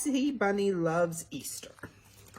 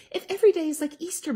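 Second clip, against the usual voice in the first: high, quick bunny voice; eager little bunny reply; Betty Bunny voice; 7 semitones higher, much faster than usual. Acted. Betty Bunny answering her mother, high and quick. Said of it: "Betty Bunny replied".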